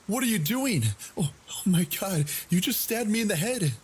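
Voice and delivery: retold screaming in shock and fear; screaming in panic. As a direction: scream in terror at the attacker. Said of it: "started screaming".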